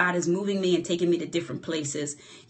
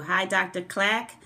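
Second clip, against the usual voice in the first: normal talking voice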